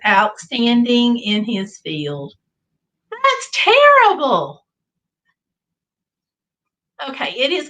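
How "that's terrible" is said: high, slow, loud drawn-out groan; mock outrage. Real, not acted. To chat after the scarecrow pun, mock outraged groan. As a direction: stretch it out like it hurts.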